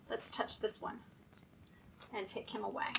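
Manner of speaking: hushed, half-whispered aside; soft aside. Real, not acted. Quiet aside to children.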